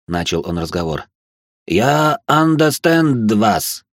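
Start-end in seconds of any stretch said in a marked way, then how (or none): 1.7-3.8 put-on slow, broken foreign English accent; halting alien learning english. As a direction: mangle the words like a foreigner. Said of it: "страшно коверкая слова".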